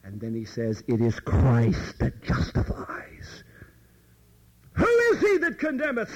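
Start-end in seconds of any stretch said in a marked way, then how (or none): 0.9-3.4 whisper. Acted. Paul's answer quoted. 4.7-6.1 much higher, louder, falling; "Who is he that condemneth?" thrown out high with a falling end; high emphatic question. Shown as a demonstration. Preacher repeating Paul's question to the congregation, high emphatic fall. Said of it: "repeats Paul's question".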